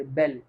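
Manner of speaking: low "बेल" with short open e; French pronunciation. Shown as a demonstration. Teacher repeating the correct French word, careful demonstration. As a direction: model "बेल" like English bell, not Hindi bail. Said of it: "ये हिंदी वाला बैल नहीं है".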